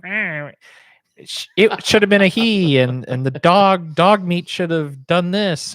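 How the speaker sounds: high, loud nitpicking fanboy; whiny internet fan. Retold or imitated. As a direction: complain like a fussy know-it-all fan. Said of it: "that guy on the internet where he's like".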